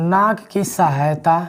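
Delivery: slow dictation, pausing between phrases; dictating word by word. Shown as a demonstration. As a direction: spell it out slowly so they can write. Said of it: "तो हम लिख देते हैं".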